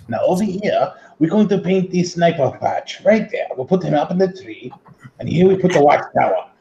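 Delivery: high-pitched mock German accent; Bill Alexander. Teasing grandma with the German painter, mock German accent. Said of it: "he sounds German".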